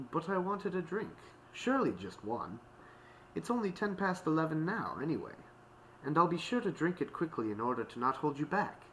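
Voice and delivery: high-pitched polite plea; polite pleading. A narrator's reading. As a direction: plead nicely for one last drink. Octavia pleads for one drink.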